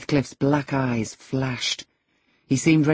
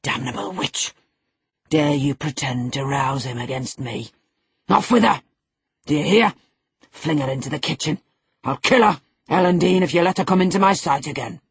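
seethe, then erupt into shouted orders; enraged master barking threats; furious; 4 dB louder than usual. A narrator's reading. Heathcliff rages and orders her out.

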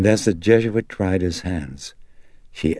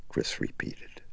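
usual speaking voice

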